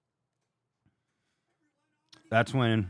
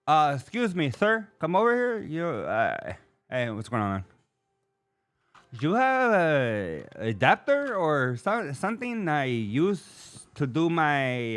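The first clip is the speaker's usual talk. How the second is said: higher, slow put-on voice for the worker; worker asking; 4 semitones higher, slower than usual. Worker asking the homeowner for an adapter, slow and higher. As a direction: drag out the request like the worker. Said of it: "the first thing he says".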